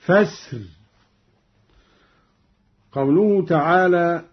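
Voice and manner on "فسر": فسر stopped on a thin, light ra. Shown as a demonstration. Teacher demonstrates stopping on the word, ra kept light. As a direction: pause on فسر and keep the ra thin. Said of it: "بترقيق الراء ... هكذا".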